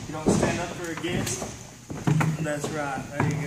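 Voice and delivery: cocky champ, loud; demanding respect like a heel; wrestling promo. Acted.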